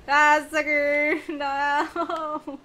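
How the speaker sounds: taunting. Real, not acted. Taunting after attacking a player.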